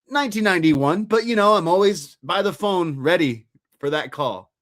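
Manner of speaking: high, insistent retold reply; defensive wrestler. Ex-wrestler answering about his last match, imitated defensive eagerness. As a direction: imitate eager self-justifying.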